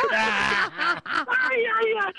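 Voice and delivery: high laughing ay ay ay; laughing groan. Real, not acted. Guest laughing at how naive he was, high laughing groan. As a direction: laugh at your own foolishness. Laughing at his own foolishness.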